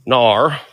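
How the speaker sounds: "Nar" as an Australian no, drawn into a hard R; mock Australian "Nar" ending on R. Shown as a demonstration. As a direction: say "Nar" with an exaggerated Aussie R. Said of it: "I gotta say R more".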